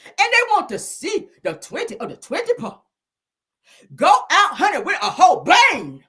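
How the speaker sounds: high, loud, soaring finale; hammed-up grand finish; dramatic poem reading. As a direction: end the poem on a big swell.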